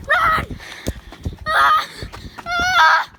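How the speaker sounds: loud high panicked scream. Pretend. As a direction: scream and bolt. Fleeing again.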